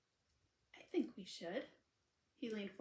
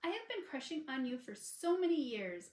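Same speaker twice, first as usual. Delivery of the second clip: blurted confession; 5 semitones higher, 6 dB louder than usual. Acted. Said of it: "she snapped".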